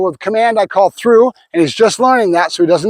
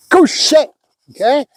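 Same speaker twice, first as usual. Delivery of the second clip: command to the dog; 6 semitones higher than usual. Real, not acted.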